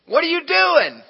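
high, loud challenge to a stranger; outraged shout. Retold or imitated. Husband defending his wife to a driver, outraged shout. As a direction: yell it like you're ready for a fight.

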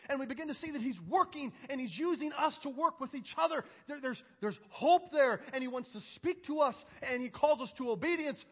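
high, loud, driving sermon delivery; fervent preaching. Acted. Preacher exalting the King of Kings to church, fervently. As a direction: build in praise, punching key words loudly.

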